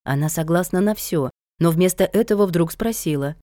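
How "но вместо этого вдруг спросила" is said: ordinary delivery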